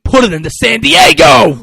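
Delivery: high, very loud battle cry; rallying shout. Retold or imitated. Coach sending the team out against San Diego, rallying shout. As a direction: bellow it to fire up the team.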